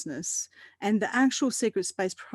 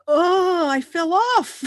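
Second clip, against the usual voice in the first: sudden realization; 9 semitones higher, 8 dB louder, much slower than usual. Retold or imitated. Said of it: "I was like".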